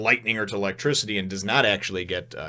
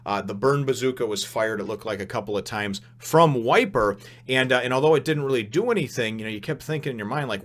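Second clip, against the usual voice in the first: normal talking voice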